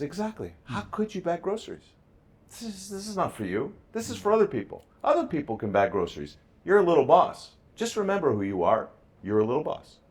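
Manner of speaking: excited pep talk. Retold or imitated. Dad telling his son he's the little boss, high and excited. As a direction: hype him up, voice soaring.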